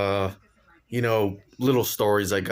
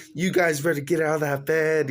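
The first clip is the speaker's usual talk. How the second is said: higher, stern mom order; angry mom; 6 semitones higher than usual. Retold or imitated.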